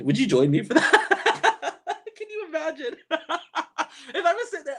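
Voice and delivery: high, giggling through the words; laughing. Real, not acted. Laughing at her own pickle plan.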